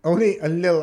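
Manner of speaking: shocked, loud echo; incredulous repeat. Real, not acted. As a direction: say it in disbelief. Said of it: "Oh my God".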